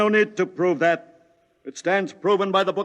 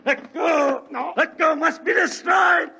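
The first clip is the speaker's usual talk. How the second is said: frantic shouting; 9 semitones higher than usual. Acted. Cultist wrestling the reporter, high frantic shouts. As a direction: shout it while fighting to break free.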